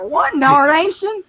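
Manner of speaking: loud, high, slow southern exclamation; over-the-top southern outburst; hammed-up southern accent. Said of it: "trying to be southern".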